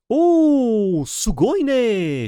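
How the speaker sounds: a sudden anime-style squeal of delight; thrilled outburst. Real, not acted. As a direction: shriek with joy at the pull. Thrilled by a rare card pull.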